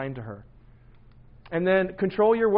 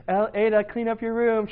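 higher, slightly raised parental call; mock nice request; 7 semitones higher, 5 dB louder than usual. Dad telling his daughter to tidy up, mock nice. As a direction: retell a supposedly nice order that edges toward yelling. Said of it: "I said it in a really nice way".

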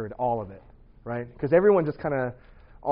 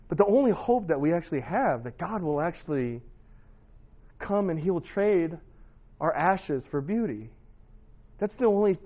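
louder, higher, earnest preacher's push; rising preaching; 5 semitones higher, 7 dB louder than usual. Acted. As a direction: drive the lesson home to the congregation. Preaching climax of testimony.

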